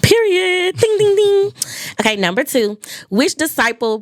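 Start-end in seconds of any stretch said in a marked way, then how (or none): 0.0-1.5 high, loud ding ding ding; excited game host. Acted. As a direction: ring it out like a quiz bell. Game host calling a correct answer.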